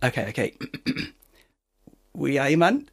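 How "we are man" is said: a lightly put-on, unconvincing Geordie accent; bad Geordie accent. Shown as a demonstration. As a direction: try a Geordie accent and fall short. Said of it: "Shall I try and do a Geordie accent?".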